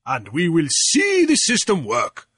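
loud, high royal proclamation; proclaiming king. A narrator's reading. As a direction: proclaim it triumphantly to the court. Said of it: "the king proclaimed".

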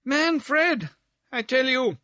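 high, insistent lady calling out; bawled interruption. Acted. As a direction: bawl the correction across the room. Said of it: "here bawled out Miss Barbleu".

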